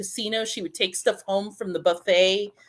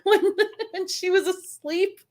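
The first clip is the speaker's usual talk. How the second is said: laughing; 9 semitones higher than usual. Real, not acted. Giggly confession about hidden donuts.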